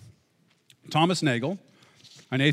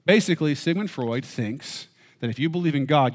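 ordinary talk, nothing marked

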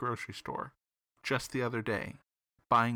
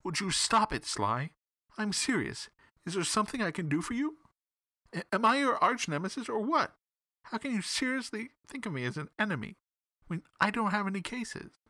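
high-pitched, indignant complaint; a touch of hurt anger, pitched high; angry; 9 semitones higher than usual. A narrator's reading. Detective protests Sly's teasing, high and indignant. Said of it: "I said angrily".

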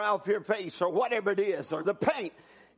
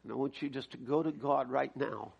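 low, hushed pastoral invitation; soft and low, easing them toward prayer; gentle altar call; 8 semitones lower, 7 dB quieter than usual. Real, not acted. Preacher invites the congregation to receive the word, gentle altar call.

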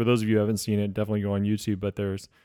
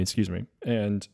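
normal talking voice

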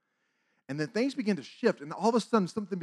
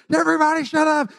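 imitated coaches' yell; 11 semitones higher, 12 dB louder than usual. Coaches quieting a noisy crowd, shouting. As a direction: holler it like a fed-up coach.

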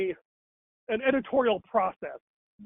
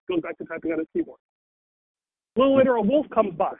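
ordinary delivery

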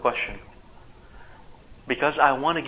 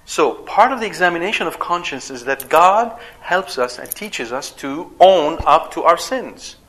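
ordinary talk

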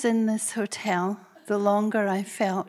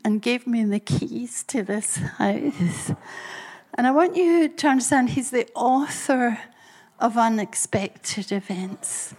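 usual speaking voice

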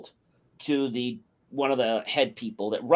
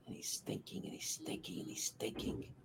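whispered thinking; 20 dB quieter than usual. Retold or imitated. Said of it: "drawn-out pondering narration".

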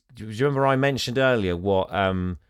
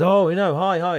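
nervous chirpy greeting; 9 semitones higher, 3 dB louder than usual. Retold or imitated. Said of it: "goes out and says".

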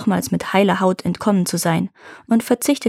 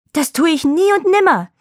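high, loud terrified cry; horrified shout; 9 semitones higher, 4 dB louder than usual. Acted. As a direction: yell it in horror. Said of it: "schrie da der Schneider entsetzt".

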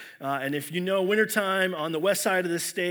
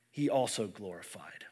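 hushed, breathy reading of the last words; whispering the verse's close; whispered; 8 semitones lower, 8 dB quieter than usual. Acted. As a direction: let the verse fall to a whisper. Scripture reading trailing to whisper.

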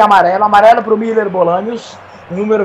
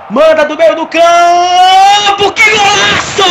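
rising, louder shout; building goal call; 7 semitones higher, 5 dB louder, much slower than usual. Acted. (narração crescendo)

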